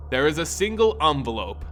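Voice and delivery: plain reading voice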